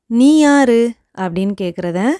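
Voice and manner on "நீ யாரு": angry. Shown as a demonstration. Teacher showing learners an angry who-are-you. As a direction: snap the question out in anger. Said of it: "இது நம்ம கோவத்தோட".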